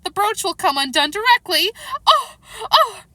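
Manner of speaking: high complaint breaking into loud shrieks; wailing. A narrator's reading. The poor Queen dreads the brooch, wailing. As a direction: wail it, then scream the ohs.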